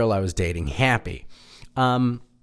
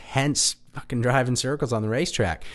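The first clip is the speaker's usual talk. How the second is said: ordinary talk, nothing marked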